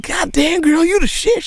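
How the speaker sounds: put-on very high, squealing girl-talk voice; high mock squeal of praise; hyped-up compliment. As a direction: gush like you just met your new best friend. Imagined meeting mom, hyped compliment.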